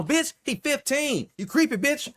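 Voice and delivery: high-pitched, drawn-out indignant outburst; mock outrage. What he wanted to yell at her.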